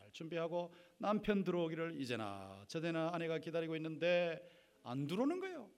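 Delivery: ordinary talk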